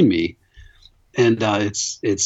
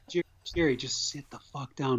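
much softer urgent hiss at a friend; buddy quietly scolding drunk Jerry; hushed scold; 11 dB quieter than usual. Retold or imitated. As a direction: hush him down in a dark theater. Buddy hushing drunk in theater.

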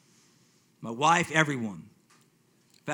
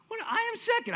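a high startled what, caught off guard; surprised; 5 dB quieter than usual. Retold or imitated. Man recalling finding the video online, startled surprise. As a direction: react in disbelief at the title.

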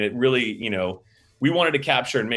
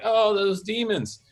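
slow, higher, understated line reading; imitating Pat's underplayed take; 11 semitones higher, much slower than usual. Director imitating actor's first try at demon line, underplayed. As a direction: say it slowly with no scare in it. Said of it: "Pat would be like".

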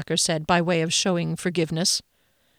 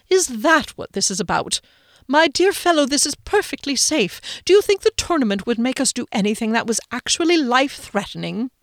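lightly voiced breezy toff, a bit higher; a hint of a dismissive gentleman; 6 semitones higher than usual. A narrator's reading. Rickenbacker dismisses Phoenix's fear with light airy reassurance. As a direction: brush off his fears airily.

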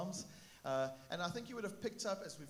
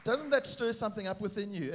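ordinary talk

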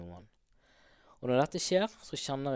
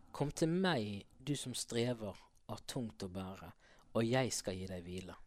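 soft reading; 4 semitones lower, 6 dB quieter than usual. Retold or imitated. Reading Bible verse aloud, moved.

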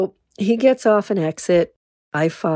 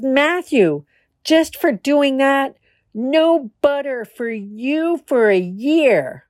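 higher, louder scolding mom voice; horrified mom; 8 semitones higher, 4 dB louder, slower than usual. Acted. Mother scolding her son for squishing the butterfly, horrified. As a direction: scold the boy like a shocked mother. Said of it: "The mom is horrified".

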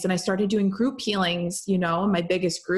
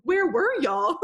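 higher, laughing through the words; laughing quote; 6 semitones higher than usual. Retold or imitated. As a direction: tease while cracking up. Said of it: "was like".

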